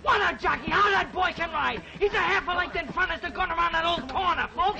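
excited, high-pitched play-by-play; race commentary. Acted. Boy calling an imaginary horse race for the gang, excited commentary. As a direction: call the race to the crowd at full tilt.

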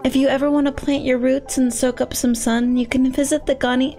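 gentle, hesitant character voice; sweet, tentative gardener; timid flower gardener. Acted. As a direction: introduce yourself shyly, a little awkward. Voices Blossom, shy gardener.

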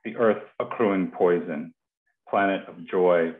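usual speaking voice

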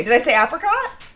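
usual speaking voice

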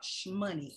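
"shmoney" with a playful sh sound on the front. Pretend. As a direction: slip a sh before money: "shmoney". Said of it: "I gotta say it like that".

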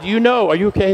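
normal talking voice

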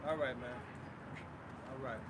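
ordinary talk, nothing marked

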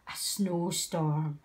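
plain reading voice

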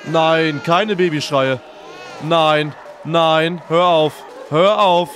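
loud frightened begging; scared pleading. Real, not acted. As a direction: beg it to stop, panicking. Scared by baby cries in game.

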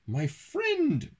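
very high, gleeful storybook exclamation; high character voice, mock chortle without real laughter; chortled. A narrator's reading. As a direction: pitch it way up high for the boy. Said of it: "chortled James".

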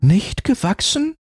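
put-on very high, astonished child's voice; surprised little girl. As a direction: protest like a startled child.

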